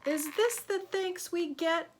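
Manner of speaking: high, put-on indignant little voice; indignant question mark. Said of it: "asked a question mark".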